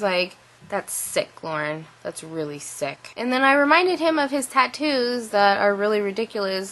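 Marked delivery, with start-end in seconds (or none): 0.7-3.1 soft, low, hushed disapproval; a hint of a disappointed father; dad to daughter, quietly appalled; disapproving dad.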